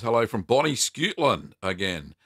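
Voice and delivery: put-on Scottish accent for a greeting; best Scottish accent. Host reading a viewer's hello from Scotland, playful Scottish accent. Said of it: "that was my best Scottish accent".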